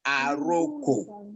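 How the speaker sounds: aroko said slowly, syllable by syllable: a-ro-ko; aroko, each syllable clear and drawn out; Yoruba word. Shown as a demonstration. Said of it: "Yoruba call it".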